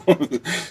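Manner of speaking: short laugh. Real, not acted. Laughing at miserable inmate.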